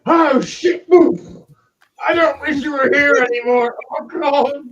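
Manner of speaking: high panicked screaming; panicked yelp. Acted. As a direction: shriek in sudden terror. Dwarf panics as orc lands.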